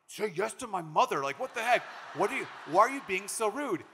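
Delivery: a groom scolds his fiancée for refusing his mother's food; exasperated fiancé. Retold or imitated. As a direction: push her to be polite, fed up.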